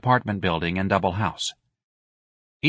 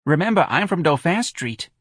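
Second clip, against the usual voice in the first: put-on high, animated old-neighborhood voice; reminiscing Mrs Riley; 9 semitones higher, 4 dB louder than usual. Mrs Riley recalls Dauphin Street to friends, animated. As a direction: boast of your local roots.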